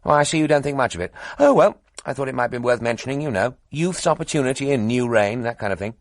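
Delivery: high, loud and bubbly; eager young toff. A narrator's reading. Cheerful young reporter telling two editors gossip, gushing. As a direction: rattle on brightly, pleased with the news.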